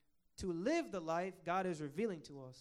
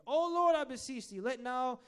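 raised, loud plea to God; crying out in prayer; 7 semitones higher, 7 dB louder than usual. Retold or imitated. Preacher reading Nehemiah's prayer for Israel, crying out. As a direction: lift the voice high in earnest appeal. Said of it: "he starts crying out".

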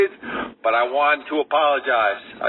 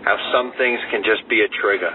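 ordinary talk

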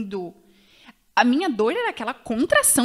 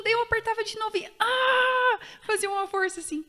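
straining push; 9 semitones higher than usual. Retold or imitated. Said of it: "cry shout".

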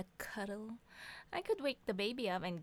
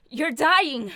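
much louder, higher "You're dying!"; hammed-up panic shout; 6 semitones higher, 14 dB louder than usual. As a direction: cry it out in mock horror. Mock panic over bad news.

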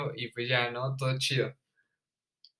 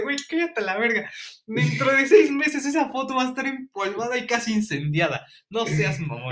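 higher, scornful mock jeer; mocking retort; 7 semitones higher than usual. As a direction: sneer it at the director's speech. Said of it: "Y nosotros como de".

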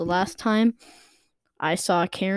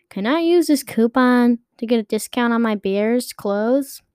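lightly voiced polite young boy, a bit higher; nice boy; 4 semitones higher, 4 dB louder than usual. Polite boy asking the cashier about his coupon, lightly voiced. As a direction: ask sweetly like a little kid. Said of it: "this nice boy says".

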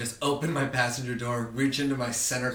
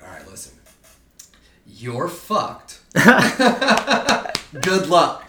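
laughing dad voice; 5 semitones higher, 11 dB louder, much slower than usual. Retold or imitated. Dad on phone, laughing retelling.

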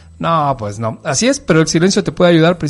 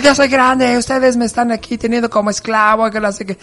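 put-on much higher whiny teenager talking back; mock teen protest; 9 semitones higher than usual. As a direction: protest like a fed-up teen, hammed-up. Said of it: "Y tú respondes igual".

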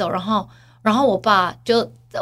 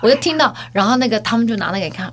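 usual speaking voice

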